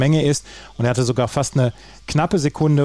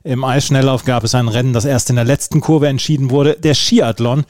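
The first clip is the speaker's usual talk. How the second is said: punchy, lower-pitched sports news teaser; headline read; 3 semitones lower, 4 dB louder than usual. Acted. Host teases the day's Olympic results to listeners, punchy headline read.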